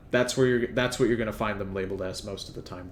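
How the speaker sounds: ordinary talk, nothing marked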